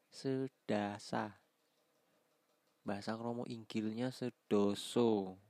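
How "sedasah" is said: sedasah stretched slowly; slow repeat. Shown as a demonstration. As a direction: drag out "Sedasah" syllable by syllable. (ucapkan pelan)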